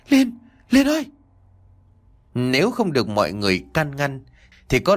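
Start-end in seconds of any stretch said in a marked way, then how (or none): 0.1-1.1 high, loud cry for his wife; loud shout. A narrator's reading. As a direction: call out to her at full voice. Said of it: "Anh liền hét lớn".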